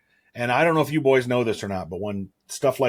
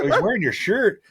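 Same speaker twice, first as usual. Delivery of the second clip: mock disbelief; 11 semitones higher, 3 dB louder than usual. Retold disbelieving reply.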